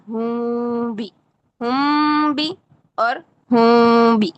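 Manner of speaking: ہم بھی slow with lips barely apart and nasal hum; teacher contrasts hidden meem slowly. Shown as a demonstration. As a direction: say ہم بھی hiding the meem, slight lip gap. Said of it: "فرق محسوس ہو رہا ہے؟".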